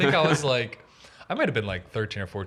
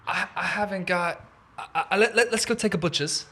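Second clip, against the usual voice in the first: mock Cockney; 7 semitones higher than usual. Shown as a demonstration. Performs Cockney slang line.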